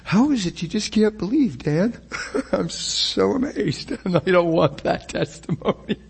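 high laughter bubbling through the words; laughing. Acted. Jesus amazed at Dan's unbelief, joked while laughing.

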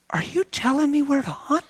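high-pitched, overwhelmed questioning; weepy. Retold or imitated. Hunter asking the Lord about hunting, retold as weepy. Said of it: "I was weepy".

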